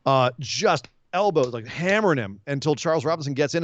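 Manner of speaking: usual speaking voice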